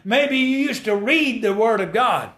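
climbing gospel preacher cadence; preaching at full force. Acted. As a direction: lift the congregation up. Preacher exhorts congregation.

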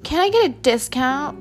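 lightly voiced stingy customer; mock cheap client. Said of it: "men that will be like".

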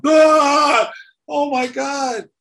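high yelp, then a slow cry of "Oh my God"; hammed-up jolt of pain; mock shock scream. Reliving the microphone electric shock, mock yelp.

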